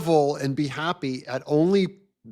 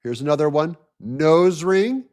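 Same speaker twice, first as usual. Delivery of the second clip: normal talking voice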